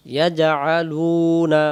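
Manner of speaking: ইয়াজআলুনা very slowly, with the লু long; ইয়াজআলুনা recited slowly with a long লু; drawn-out recitation. Shown as a demonstration. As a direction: stretch ইয়াজআলুনা, holding the লু sound. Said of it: "ওয়া অক্ষরটা টান দেওয়ার জন্য".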